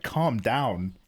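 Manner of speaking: drawn-out, very slow telling off; mock exasperation. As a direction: stretch out calm the fuck down. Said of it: "I was just like".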